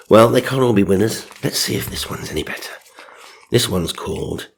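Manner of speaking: ordinary talk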